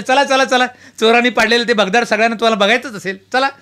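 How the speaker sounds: loud call to the guests to come see the break-in hole; calling everyone along. Retold or imitated. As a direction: herd the crowd upstairs, loud and high. Herding guests upstairs.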